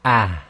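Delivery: a low, light ah with no uh at the end. Shown as a demonstration. Teacher giving students the final light ha. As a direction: breathe out a short light ah. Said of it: "so we just want".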